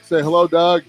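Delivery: ordinary delivery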